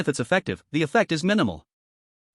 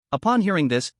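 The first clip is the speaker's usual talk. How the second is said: usual speaking voice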